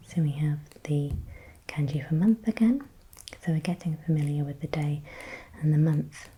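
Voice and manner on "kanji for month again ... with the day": ordinary talk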